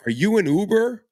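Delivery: high, loud imitation of the stranger asking; imitating a puzzled stranger. Retelling how a stranger asked if he drove Uber, high and loud.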